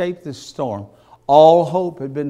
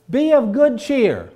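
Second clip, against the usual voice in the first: Paul's encouragement; 8 semitones higher, 5 dB louder than usual. Acted. Paul encourages the crew.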